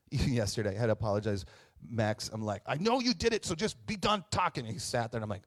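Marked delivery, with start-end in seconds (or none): 2.7-4.6 retold sharp scolding, much higher; stern parent. Pastor retelling scolding son Max, sharp and high. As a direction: cut the kid off like an impatient parent.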